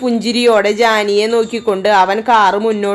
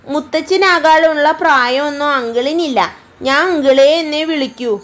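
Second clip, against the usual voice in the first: put-on higher, teasing girl; playful young girl; 5 semitones higher than usual. Girl teasing the uncle, higher and playful. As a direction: tease the uncle about his age.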